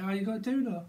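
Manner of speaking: high-pitched Aussie question; Aussie accent. Acted. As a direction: put on a mock Australian accent. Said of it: "someone could be from, uh, Aussie".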